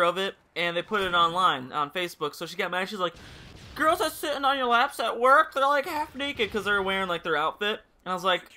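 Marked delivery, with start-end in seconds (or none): 3.8-6.5 mock jealous girlfriend. Boyfriend mocking his jealous girlfriend to viewers, higher whiny voice. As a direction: mimic her jealous accusation.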